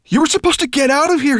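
high, loud urgent shout. Acted. Dragon shouting at the boy who failed to flee. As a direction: yell at him to get out now. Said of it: "he shouted".

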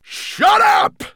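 high, loud, full bellow; furious bellow. A narrator's reading. Nettlebrand cutting off the raven, bellowed. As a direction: bellow to silence him. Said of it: "bellowed Nettlebrand".

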